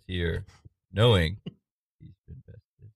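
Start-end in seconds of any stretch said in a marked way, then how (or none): 2.0-2.9 unvoiced whisper. A narrator's reading. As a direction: breathe it out in a whisper. Narrator drops to whisper.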